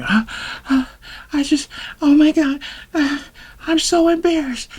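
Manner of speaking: very high, whimpering mock sobs; hammed-up tearful squeak with halting gulps; imitated crying. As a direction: imitate a mortified date choking up. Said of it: "She start crying".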